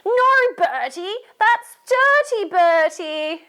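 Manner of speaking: high, loud, drawn-out telling-off; scolding. Acted. Storyteller leading children to tell Bertie off, scolding. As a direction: wag a finger and scold in singsong. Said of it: "get your finger and say".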